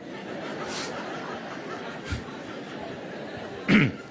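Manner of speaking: breathy laugh. Real, not acted. Laughs at own joke.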